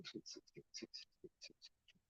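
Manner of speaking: whispered to himself. Real, not acted. Muttering while searching a title.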